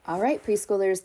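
usual speaking voice

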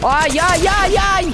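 alarmed high wail; drawn-out alarm cry; high slow ai-yai-yai. Real, not acted. Game panic moment.

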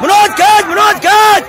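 shouted pull commands. Real, not acted. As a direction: urge the team to pull, hollering. Coach shouting pull commands to team. (ज़ोर लगवाने की ऊँची ललकार)

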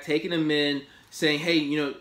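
ordinary talk, nothing marked

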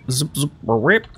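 playful mouth noise zip zip rip, rising on rip; speed-up time noise. Pretend. Gamer fast-forwarding time in his game, playful mouth noise. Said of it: "That's my speed up time noise".

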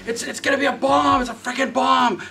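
high-pitched shouted tirade, imitated; hammed-up studio boss berating a director; angry ranting. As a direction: rail at him, high and forceful.

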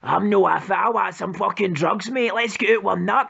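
usual speaking voice

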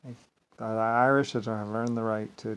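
ordinary delivery

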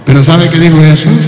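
ordinary talk

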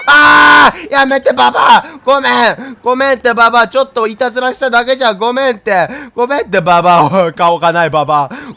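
loud, high pleading cries; panicked yelling. Real, not acted. Streamer shouts apologies at the furious old woman, panicked. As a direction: beg the angry granny to stop. Granny attacks, streamer yells.

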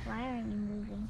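unvoiced, scared whisper; whispered question. Child retells seeing a figure in the window, whispering. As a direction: breathe it out like a spooked kid. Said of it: "and I was like".

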